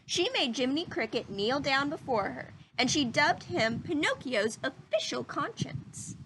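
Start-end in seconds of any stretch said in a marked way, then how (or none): none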